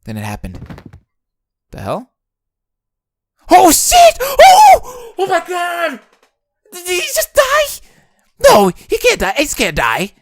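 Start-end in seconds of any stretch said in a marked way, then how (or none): 1.7-2.1 startled. Acted. Startled reaction. 3.5-10.1 high, shouting in panic; panicked shout. Acted. Panic at collapse.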